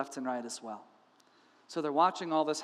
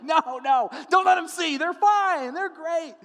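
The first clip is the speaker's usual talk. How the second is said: high, loud, hammed-up whisper-shout of a disciple; frantic disciple hushing the others, much higher and louder; mock panicky disciple; 10 semitones higher, 7 dB louder, slower than usual. Disciples hiding the crowd from Jesus, frantic and high.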